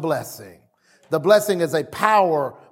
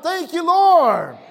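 congregational praise shout; 10 semitones higher than usual. Shown as a demonstration. Preacher urging the congregation to give thanks, high shout. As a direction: shout thanks for all to join. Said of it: "Somebody shout".

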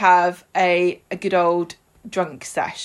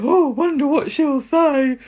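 higher, slow mock wistful musing; hammed-up wondering Peter; 7 semitones higher, 3 dB louder, slower than usual. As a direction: put on a drawn-out, higher-pitched wondering voice. Acting out waiting, hopeful Peter.

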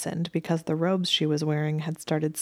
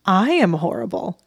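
louder scoffing repeat; 3 semitones higher, 6 dB louder than usual. A narrator's reading. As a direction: scoff at the accusation. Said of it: "he scoffed".

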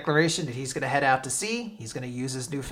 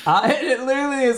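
ordinary talk

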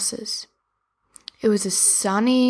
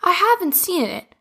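high, bright shopkeeper; cheerful doll voice; 6 semitones higher than usual. Acted. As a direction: greet him like a chirpy doll. Betty Barbie the baker.

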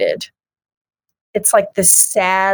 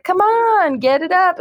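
put-on much higher, teasing girl urging him on; high coaxing girl voice; 10 semitones higher than usual. Woman in the comic teasing the limp man, voiced high and coaxing. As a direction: coax it up high like a playful girl. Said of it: "of course she's like".

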